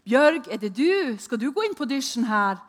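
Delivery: loud, high calling out through the house; shouting her name. Retold or imitated. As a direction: call out to Bjørg across the hall. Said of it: "jeg ropte med høy røst".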